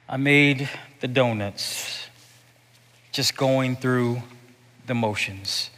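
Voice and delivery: slow, low, drained monotone; weary. Acted. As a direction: sound like the life's gone out of you. Mimics weary zombie worker.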